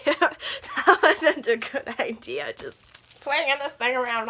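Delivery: giggly, a bit embarrassed; laughing. Real, not acted. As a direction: chuckle at your own clumsiness. Laughing after the mishap.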